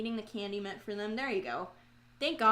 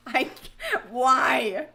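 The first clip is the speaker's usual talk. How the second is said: loud, exasperated cry; mad; 3 semitones higher, 9 dB louder than usual. Real, not acted. Streamer lamenting a painting slip, mad at self. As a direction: cry out in frustration at yourself. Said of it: "I am so mad".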